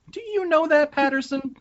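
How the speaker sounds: high squeaky incredulous voice; high mock voice. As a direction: squeak it out in disbelief. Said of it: "And I was like".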